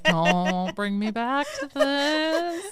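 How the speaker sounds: slow, rising, drawn-out plea; mock wail. Host joking to friend about siren vibes, mock wailing plea.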